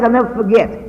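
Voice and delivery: ordinary delivery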